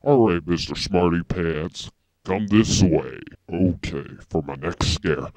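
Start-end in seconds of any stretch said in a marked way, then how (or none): none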